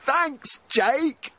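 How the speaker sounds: very high, loud shouting duck; squeaky duck shouting with joy; overjoyed duck. A narrator's reading. Duck gets quack back.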